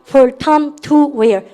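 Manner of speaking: very slow, each word apart; slow drill read. Shown as a demonstration. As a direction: lead the class slowly through it. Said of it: "시작". (천천히 또박또박 따라 읽기)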